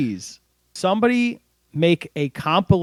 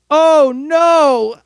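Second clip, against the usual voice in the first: high, loud drawn-out wail; mock-anguished yell, pitched way up; imitated cry of dismay; 12 semitones higher, 7 dB louder than usual. Imitating a hurt cricketer for a friend, high wailed dismay. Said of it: "you hear him go".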